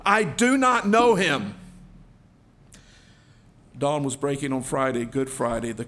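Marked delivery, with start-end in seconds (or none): 0.1-1.6 loud, raised, exasperated outburst; curse, then deny it slowly and forcefully; acted angry disavowal; cursing denial. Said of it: "with a curse this time says".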